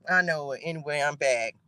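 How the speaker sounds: ordinary delivery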